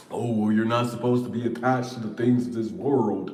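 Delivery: a lightly mocking, preachy lecture voice; preachy spiritual person. Mocking the spiritual crowd, a mild preachy imitation. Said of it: "mocking spiritual advice givers".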